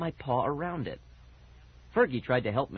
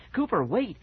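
urgent call, pitched up; mildly raised, higher cry; 7 semitones higher than usual. A narrator's reading. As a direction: call to a friend to stop. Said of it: "Fergie cried".

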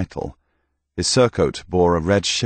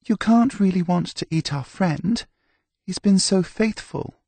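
put-on much higher young woman's voice, pleading gently; pleading Delphine; 13 semitones higher than usual. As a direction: beg for your friend the mule in a high voice.

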